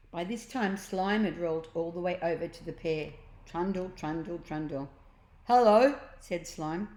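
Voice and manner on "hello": much louder cheerful blob; friendly loud hello. A narrator's reading. Slime greets.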